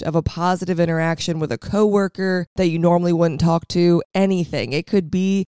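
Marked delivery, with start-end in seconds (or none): none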